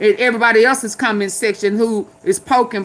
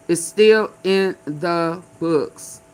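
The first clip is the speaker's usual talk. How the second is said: "still" leaned on, whole line very slow and deliberate; stress on still; 6 semitones lower, much slower than usual. Shown as a demonstration. Spelling out to listeners that it is still law, slow stress on "still". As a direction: drag it out, hitting "still" hard. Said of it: "It's in the books".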